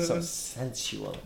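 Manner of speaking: soft, slow, breathy tease; mock sultry. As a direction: purr it out slowly. Comments on sensual dedication.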